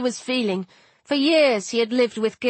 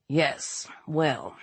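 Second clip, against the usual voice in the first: low, soft, dry aside; understated dry response; 7 semitones lower, 7 dB quieter than usual. A narrator's reading. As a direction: say it quietly, unimpressed. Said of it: "Dad said dryly".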